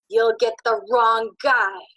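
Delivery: loud, slow, driven words; shrieking on. Acted. Ben warns Albie he has the wrong target, loud and slow. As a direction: keep pushing the frantic shout. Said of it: "Ben shrieked".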